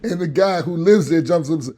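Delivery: ordinary talk, nothing marked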